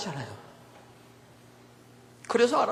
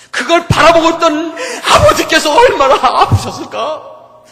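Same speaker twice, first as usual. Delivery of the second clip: loud, slow preaching cry, higher and breaking; 6 semitones higher, 12 dB louder than usual. Real, not acted. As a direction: cry out the Father's pain at full force. Preacher imagines the Father's pain. (울먹이며 크게 외치는 설교)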